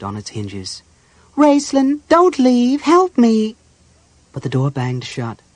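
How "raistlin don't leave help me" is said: high, loud cry for help; high-pitched panicked begging; desperate plea. Acted. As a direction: call out desperately as someone abandons you. Sick Tass begs not to be left.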